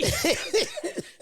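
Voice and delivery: high, loud real laugh; loud burst of laughter. Real, not acted. Laughing at her own story.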